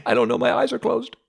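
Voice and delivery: panicked reply. Retold or imitated. Clinging to the pastor in the water, answering in high panicked fear. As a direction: blurt it out in a frightened rush.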